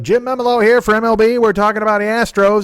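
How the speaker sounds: high, loud radio announcer; hammed-up sports radio host intro; pumped-up radio jock, high and loud; Jim Memolo radio voice. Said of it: "and the guy's like".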